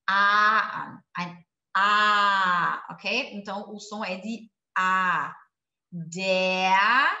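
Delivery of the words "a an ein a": A an, ein A called out loud; emphatic vowel demo. Shown as a demonstration. Teacher loudly stressing the A sound. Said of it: "es hört sich wie ein".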